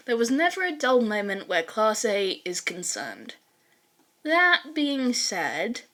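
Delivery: clear, steady storytelling read; story narration. A narrator's reading. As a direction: podfic narrator telling the tale. Podfic reader narrates fanfic.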